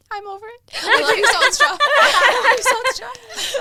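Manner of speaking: high, loud, giggling sing-song goodbye; a squealing, laughing send-off; laughing mock farewell. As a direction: gush at the old job through laughter. Mock farewell to old job.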